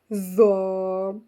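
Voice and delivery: ज़ौ drawn out, lips rounded; heavy z. Shown as a demonstration. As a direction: stretch ज़ौ with a heavy buzzing z. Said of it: "अब इसी को अगर हम इसके साथ बोलें".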